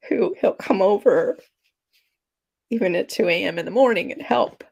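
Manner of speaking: fighting back real tears, voice wavering up. Real, not acted. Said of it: "I cry through my nose".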